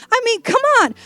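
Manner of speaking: hammed-up sassy telling-off, mostly pitched high; wound-up arguing. Eve fighting back at Adam for blaming her, wound up. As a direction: play a fed-up wife building to a mock threat. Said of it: "a little bit wound up".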